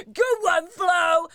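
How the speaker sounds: loud high hammed-up laugh into praise; hyped entourage laughing along; mock hype laugh. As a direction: cackle like a sycophantic posse. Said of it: "they all just being like".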